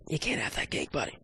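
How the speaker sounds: hushed, whispered telling-off; barely voiced mock admonishment; mock scolding whisper. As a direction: whisper it like a playful warning.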